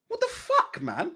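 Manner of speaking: incredulous high-pitched cry; exasperated outburst. Real, not acted. Vlogger cries out in disbelief at the doping news. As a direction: yelp it in disbelief. Outraged at failed dope tests.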